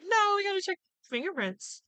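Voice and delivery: mock Columbo calmly holding his ground; lightly voiced detective insisting on the fingerprints. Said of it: "And he's like".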